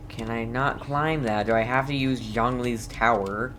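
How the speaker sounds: ordinary talk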